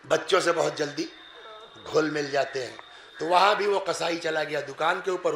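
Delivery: ordinary talk, nothing marked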